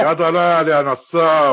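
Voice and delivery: loud, high, drawn-out accusation; angry insult. Acted. Cheated man denouncing the swindler, furious. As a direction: hurl the insult at him.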